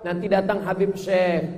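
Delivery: ordinary talk, nothing marked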